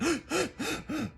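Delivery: sudden high sharp gasp, acted out; imitated gasp. Recalling the ice bath, a sudden high imitated gasp. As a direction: mimic a panicked gasp from the cold. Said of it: "I was no longer like".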